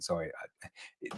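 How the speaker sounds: ordinary delivery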